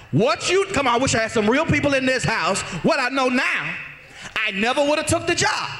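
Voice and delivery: fiery preacher stretching key phrases over the crowd; full-force preaching. Acted. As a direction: belt it out to rouse the congregation.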